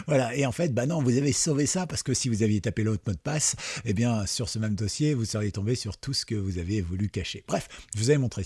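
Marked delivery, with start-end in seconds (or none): none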